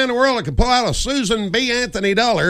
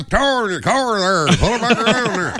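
put-on rhotic North Georgia accent; heavy North Georgia accent, R's rolled; 2 semitones higher than usual. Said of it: "everything got a R in it".

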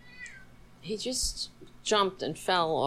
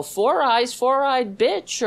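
loud, higher schoolyard jeer, dragging the second part; mocking bully taunt; 6 semitones higher, 6 dB louder than usual. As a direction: sneer it like a playground bully. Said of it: "We don't go".

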